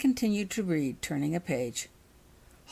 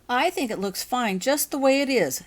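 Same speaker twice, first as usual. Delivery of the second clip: firm; 6 semitones higher, 5 dB louder than usual. A narrator's reading. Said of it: "stated Becky firmly".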